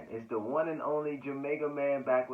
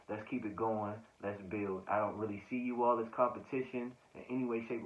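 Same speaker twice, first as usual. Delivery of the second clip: ordinary delivery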